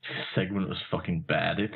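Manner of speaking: ordinary talk, nothing marked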